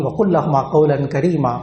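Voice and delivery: ordinary delivery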